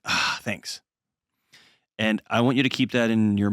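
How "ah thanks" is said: imitated breathy exhale of relief; retold relieved sigh. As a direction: sigh out your thanks softly. Retold relief of wife after resting.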